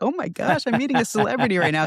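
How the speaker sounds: high, rushed starstruck gush; fangirling. Retold or imitated. Retelling a first meeting with a pickleball celebrity, fangirling. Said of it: "like, fangirling".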